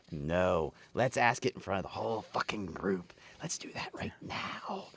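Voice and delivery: hushed, seething mock suggestion; sarcastic whisper. Staffer silently mocking the question-asker, sarcastic whisper.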